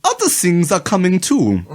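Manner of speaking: a hint of a German accent, mock dramatic. Reading the German's dramatic line aloud to friends, in a German accent. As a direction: play the doom in a German accent. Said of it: "The young German went on dramatically".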